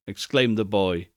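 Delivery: plain reading voice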